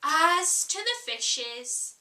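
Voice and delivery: slow, grave opening phrase; slow and solemn. Acted. Red Queen leaning to Alice's ear about fishes, slow and solemn. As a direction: drag it out solemnly. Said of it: "very slowly and solemnly".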